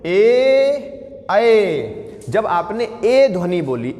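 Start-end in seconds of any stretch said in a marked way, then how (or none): 0.1-1.8 ए and ऐ held long and clear; vowel demo. Shown as a demonstration. Teacher demonstrates ए and ऐ. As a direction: sound out ए, then ऐ. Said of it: "इसमें भी जीभ कैसी हो गई?".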